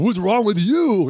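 put-on high, slow drunken comeback; drunk retort. Drunk son snapping back at dad, high and slow. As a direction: slur it back like a drunk teen. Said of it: "And I was like".

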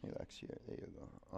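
soft unvoiced whisper. Real, not acted. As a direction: breathe the words out without voice. Whispered cue during the pose.

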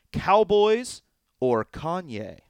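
slow, drawn-out title call; grand game-show announcement of the title. Acted.

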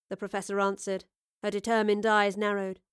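ordinary delivery

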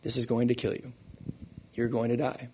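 soft, low, gentle doctor voice; bedside manner. Acted. Doctor giving patient a terminal diagnosis, bedside manner. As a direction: break the bad news softly and gently. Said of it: "use his best bedside manner to say".

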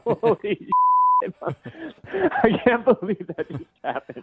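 high, slow laughing disbelief; real shock dissolving into laughs; surprised. Real, not acted. Victim realizes prank.

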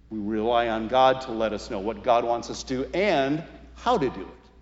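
normal talking voice

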